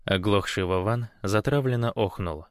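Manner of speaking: ordinary delivery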